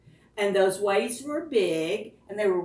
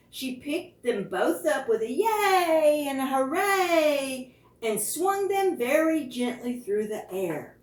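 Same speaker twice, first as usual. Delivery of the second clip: usual speaking voice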